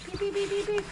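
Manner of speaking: high, soft little peeps; imitating chicks. Keeper mimicking the hatchlings, high soft peeping. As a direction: peep softly like a baby chick. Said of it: "listen to them".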